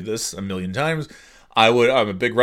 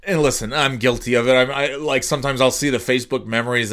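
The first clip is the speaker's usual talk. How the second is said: normal talking voice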